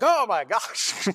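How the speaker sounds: high, drawn-out "oh my gosh"; exaggerated exasperation with a laugh behind it; mock dismay. Acting floored by hard question.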